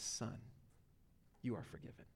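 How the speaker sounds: soft, intimate, near-whispered tenderness; tender. Acted. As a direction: say it like a loving father to his child. Voicing Jesus' tender word.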